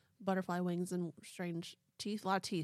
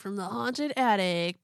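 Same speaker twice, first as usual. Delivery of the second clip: ordinary talk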